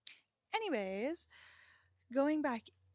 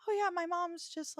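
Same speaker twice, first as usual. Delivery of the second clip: fake casual; 10 semitones higher, 5 dB louder than usual. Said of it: "I would just pretend that she was alive".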